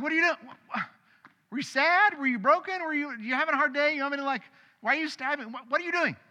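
high, incredulous questions; baffled parent. Retold or imitated. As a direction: pitch it high, bewildered and hurt. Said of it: "I'm like".

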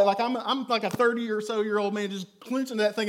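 normal talking voice

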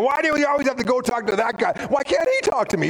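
high-pitched, fast indignant complaint; mock irritation, pitched well up; irked. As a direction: complain like you feel left out. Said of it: "I'm getting a little irked here".

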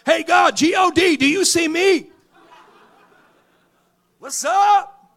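high, loud shouting at heaven; loud desperate call to God. Retold or imitated. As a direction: yell up to God to be noticed.

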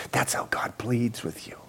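unvoiced hushed whisper to the congregation. Real, not acted. Preacher whispering to the congregation about God pleading. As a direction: drop to a breathy secret.

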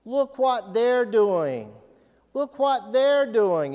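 mock outrage at others' faults; indignant complaining. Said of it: "One of the ways I take my eyes".

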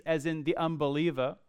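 usual speaking voice

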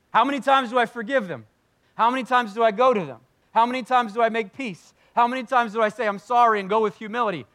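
high, loud, insistent questions; exasperated questioning. Acted. Preacher voicing a believer demanding a limit on forgiving, exasperated.

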